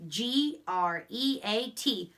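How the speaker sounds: letters spaced slowly and evenly; slow spelling out. Shown as a demonstration. Teacher spelling a sight word aloud slowly.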